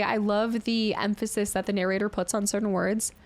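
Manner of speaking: ordinary talk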